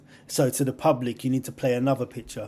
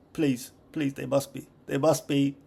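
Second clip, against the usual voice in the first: ordinary delivery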